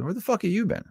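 high-pitched imitated confrontation; mock indignant. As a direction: play the buddy demanding an explanation. Acting out buddy confronting him.

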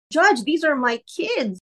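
high, drawn-out plea to a judge; mock-pleading parent; imitated tearful plea. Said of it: "Of course, he's crying".

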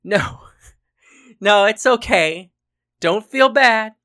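amused, laughing protest; laughing reassurance. A narrator's reading. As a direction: reassure a friend while cracking up. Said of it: "Paris was now laughing in full".

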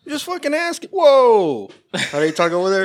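put-on high, hammy Boston accent; mock a loud Boston guy. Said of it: "Fucking Boston accent".

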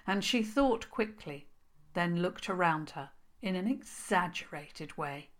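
ordinary delivery, nothing marked

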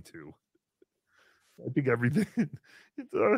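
normal talking voice

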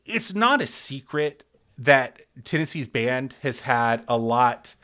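ordinary talk

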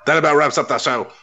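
booming sign-off; announcer voice. Acted. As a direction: wrap it up like a host. Mock show sign-off.

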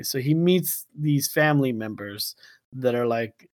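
ordinary talk, nothing marked

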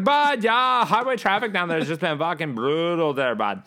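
put-on high-pitched Northern Ontario accent; hammed-up Northern Ontario small talk. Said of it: "That's Northern fucking Ontario".

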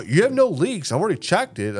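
high, loud mock repairman; AC guy voice. AC guy reassuring the dad, high mocking voice. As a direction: play the oblivious repairman.

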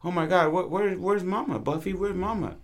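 put-on high, fussing baby talk to a dog; girls fussing over dog. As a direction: put on a high girly voice for a pet. Girls pick up and coo at dog.

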